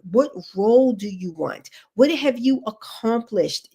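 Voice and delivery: ordinary delivery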